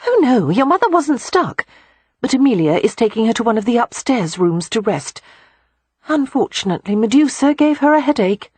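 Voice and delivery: chatty and open; lively young woman. A narrator's reading.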